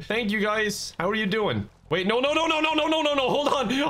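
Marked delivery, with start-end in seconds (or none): 1.9-3.9 high, rapid string of noes; high-pitched, flustered alarm; panicked protest. Real, not acted. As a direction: plead your way out of trouble. Caught in someone else's fight.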